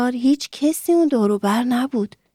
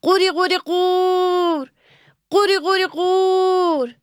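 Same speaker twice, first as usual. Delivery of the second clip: high, sing-song "قوری قوری قور" croaked like a frog; a cheerful frog croaking "قوری قوری قور" to wake the old man; happy frog croak; 9 semitones higher than usual. A narrator's reading. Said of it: "خیلی خوشحال شد و گفت".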